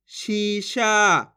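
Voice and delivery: शीशा very slow, both vowels held; syllables drawn out. Shown as a demonstration. Teacher joins the syllables, शीशा drawn out. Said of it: "जिस तरीके से मैं खींच खींच के पढ़ रहा हूँ".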